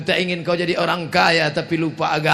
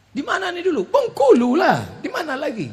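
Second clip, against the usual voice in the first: put-on child voice; 10 semitones higher than usual. Grandchild asks about old photo.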